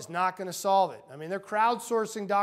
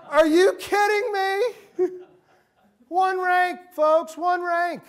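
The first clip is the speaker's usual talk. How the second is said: loud, high-pitched disbelief through laughter; exasperated, laughing holler; incredulous outburst; 10 semitones higher, 11 dB louder, slower than usual. Acted. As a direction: shout it in comic outrage at the absurdity. Mock outrage at title-laden business card.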